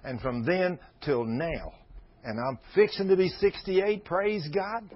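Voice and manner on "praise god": usual speaking voice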